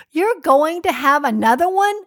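hammed-up astonished question; incredulous question. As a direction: imitate a stranger aghast at the news. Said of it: "And she goes".

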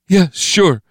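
high, hurried-sounding surrender; frightened agreement. A narrator's reading. Steve yielding to Bo Pan's grip, frightened high voice. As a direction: give in at once, pitch way up.

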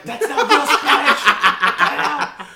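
loud, laughing retold scolding; laughing mock scold. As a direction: scold the kid while laughing. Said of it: "I'm like".

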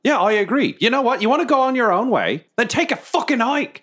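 high, heated, dismissive rant; angry rant. Acted. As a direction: tell him to get lost angrily. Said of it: "I just start a rant".

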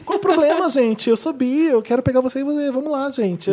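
sing-song it like she wants everyone; mock carefree bi. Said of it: "ele quer, sabe?".

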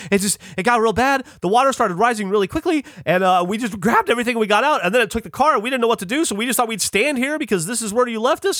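high-pitched, rushed mock-panic retelling; imitating shell-shocked friends. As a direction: babble it out high and fast, acting shaken. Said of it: "they're just shell-shocked. They can barely talk".

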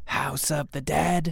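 slow, drawn-out spooky voice; eerie ghost voice. Acted. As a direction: moan it slowly like a ghost. Ghost voice options.

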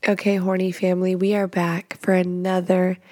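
ordinary talk, nothing marked

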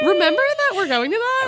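high, loud exaggerated question; mock questioning. Co-host teasing the ticket plug, mock questioning. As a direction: parody the plug with the pitch pushed way up.

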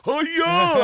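high, loud whoop; showy high-pitched shout; imitated Tom Cruise showboating. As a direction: crow it out like a pool hustler. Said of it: "doing the Tom Cruise shit".

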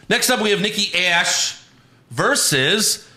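high, slow, stretched-out name; hammed-up ring introduction; mock announcer intro.

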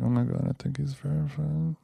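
hushed awed whisper; excited whisper. Retold or imitated. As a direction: whisper it in awe. Smoothie worker whispering.

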